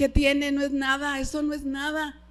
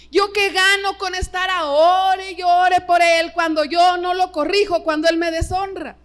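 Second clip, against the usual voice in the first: preaching shout; 4 semitones higher, 8 dB louder than usual. Real, not acted. Pastor shouting at parents in the church. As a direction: thunder it at the congregation.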